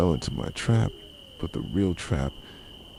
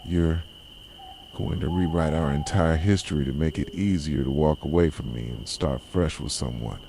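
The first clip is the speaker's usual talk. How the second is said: ordinary delivery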